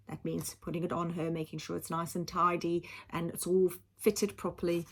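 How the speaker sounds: plain reading voice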